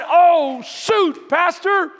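loud, high mock whine about working out; a loud, hammed-up gripe from the pews; complaining congregant. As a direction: play the groaning churchgoer who hates exercise. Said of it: "Some of us are going".

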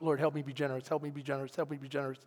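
low, hushed repeated plea; quiet prayer on a loop; murmured prayer. Retold or imitated. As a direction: pray it softly under your breath. Muttered prayer retold from the story.

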